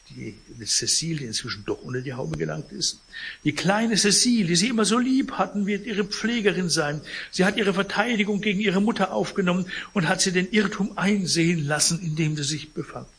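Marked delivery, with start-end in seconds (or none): 3.4-13.1 higher, quick, ingratiating lawyer; oil the words like a schemer; smooth lawyer voice. Acted. Lawyer Fraisier flatters the sick man, higher and quick.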